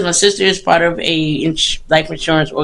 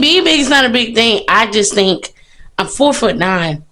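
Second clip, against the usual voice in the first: ordinary delivery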